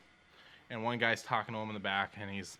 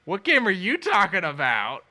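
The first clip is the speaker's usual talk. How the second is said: slow, high, loud retold question; mock incredulous shout, stretched out; incredulous demand; 10 semitones higher, 14 dB louder, slower than usual. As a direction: imitate baffled fans yelling back. Fans demand answer, confused.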